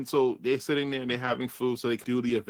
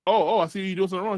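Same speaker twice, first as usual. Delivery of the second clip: higher, louder, fast mock scold; boss scolding; 7 semitones higher, 5 dB louder, much faster than usual. The boss catches Paradox at the machine, quick mock scold. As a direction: rattle it off like a bossy villain. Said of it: "She's like".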